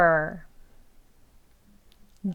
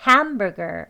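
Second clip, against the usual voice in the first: "hamburger" with strong HAM, falling "burger"; main stress on HAM; 3 dB louder, much slower than usual. Shown as a demonstration. Teacher modelling the whole word, "hamburger" stressed on HAM. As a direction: hit HAM in "hamburger", taper off. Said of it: "ham이 가장 또 강하게".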